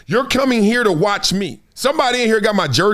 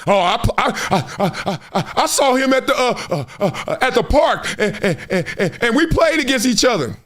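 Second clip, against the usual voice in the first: stammering fan; 3 semitones higher than usual. Retold or imitated. Imitating a stammering bragging fan.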